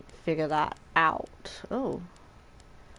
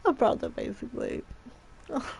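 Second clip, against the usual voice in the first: moved whisper; 6 semitones higher than usual. Real, not acted. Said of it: "That line got me".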